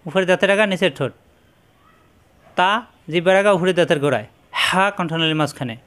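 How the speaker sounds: ordinary talk, nothing marked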